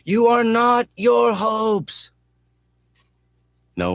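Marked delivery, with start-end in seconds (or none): 0.1-2.1 very high, very slow, loud scream. A narrator's reading. Mechanic screaming at headlights coming head on. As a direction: scream it into the oncoming glare. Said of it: "cranes forward into the glare and noise and screams".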